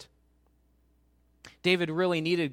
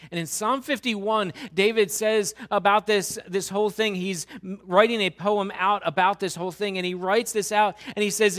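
louder, higher preaching voice; fervent sermon delivery; full-force preaching; 3 semitones higher, 5 dB louder than usual. Acted. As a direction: press the congregation with rising intensity.

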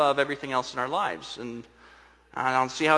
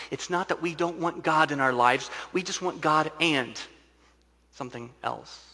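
usual speaking voice

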